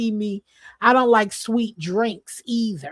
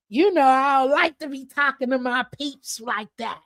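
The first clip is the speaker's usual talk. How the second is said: ordinary delivery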